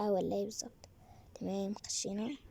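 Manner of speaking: whisper. Real, not acted. Sneaking into the new area.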